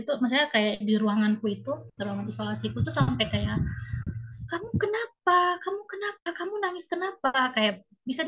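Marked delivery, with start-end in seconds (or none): 4.5-7.3 concerned nurse. Retold or imitated. Nurses asking why she cries.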